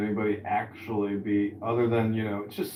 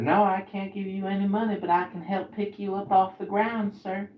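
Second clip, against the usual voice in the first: higher, firm but kind refusal; lightly voiced girlfriend; 10 semitones higher than usual. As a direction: turn down the money kindly. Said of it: "she said".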